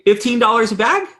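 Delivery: high rising, disbelieving question; incredulous question. Retold or imitated. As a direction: repeat the price to the father in shock. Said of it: "And I say".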